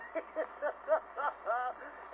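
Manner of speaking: string of short staccato chuckles; a storyteller's punctuating laugh; comic chuckle. Acted. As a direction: chortle at your own joke. Laughs at his own joke.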